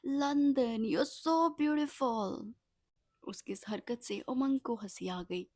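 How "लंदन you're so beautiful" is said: loud, high call out the window; drunk shouting. Acted. Drunk Hayati shouting from the window. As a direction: shout it at the city like a tipsy bride. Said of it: "वो चिल्लाने लगी".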